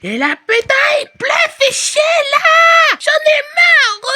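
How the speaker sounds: very high, slow, loud crying shout; a toddler meltdown in the street; screaming tantrum. Acted. (crise de hurlements en pleurs)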